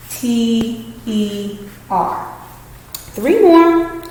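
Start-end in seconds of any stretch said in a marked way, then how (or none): 0.1-2.3 very slow letters L E T; teacher spelling letter; spelling out slowly. Shown as a demonstration. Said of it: "this one has a double letter".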